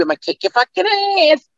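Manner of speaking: goofy character mock threat; comic movie-line menace; movie catchphrase. Shown as a demonstration. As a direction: deliver the uncensored line in character. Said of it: "it's really in the movie".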